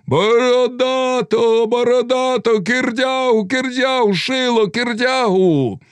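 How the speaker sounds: rival names chanted on one high pitch; high, insistent crowd cries; crowd calling out. A narrator's reading. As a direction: call like competing factions, high and sustained. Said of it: "гукали деякі".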